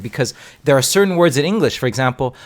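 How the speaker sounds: ordinary talk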